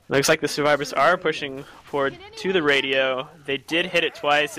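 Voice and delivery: ordinary delivery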